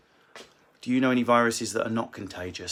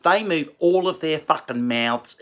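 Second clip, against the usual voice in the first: exaggerated Australian accent with huge mouth movements; Australian impression; 3 semitones higher, 3 dB louder than usual. Comedian mocking Australians to his fans, exaggerated Australian accent. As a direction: overdo the Australian vowels, mouth wide open. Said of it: "speaking like that are Australians".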